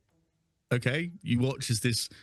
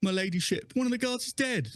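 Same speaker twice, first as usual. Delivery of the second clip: much higher, urgent report; alarmed guard reporting; 12 semitones higher than usual. Acted. Guard reports the death to her ladyship, urgent and high. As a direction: rush the bad news to your lady. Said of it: "she's like".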